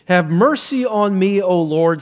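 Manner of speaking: high, loud plea read out; crying out in appeal. Acted. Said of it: "cried out to him".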